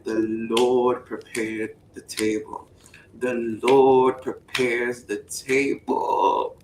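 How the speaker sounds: low, slow, deliberate; solemn reread. Pretend. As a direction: let each word sink in.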